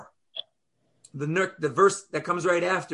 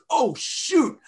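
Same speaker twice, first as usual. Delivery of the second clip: higher, sudden oh shoot; a caught-out little yelp of realization; hammed-up oops; mock dismay; 8 semitones higher than usual. Man realizes wrong train.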